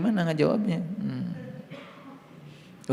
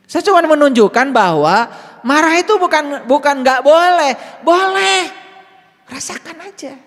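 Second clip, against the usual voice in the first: forceful preaching; 12 semitones higher, 10 dB louder than usual. Acted. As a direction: proclaim it to the whole room. Preaching that anger is allowed.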